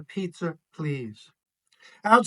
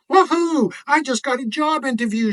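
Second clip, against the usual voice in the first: loud, high, fast whoop of joy; excited shout; 7 semitones higher, 5 dB louder, faster than usual. A narrator's reading. Miz Brown shouting her job news to the neighbors, excited. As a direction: burst out cheering over good news. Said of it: "she shouts".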